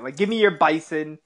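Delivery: usual speaking voice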